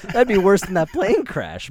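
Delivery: loud, high-pitched laughing delivery. Real, not acted. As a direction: laugh it out, slowly, full of glee. Laughing at shrimp fork death.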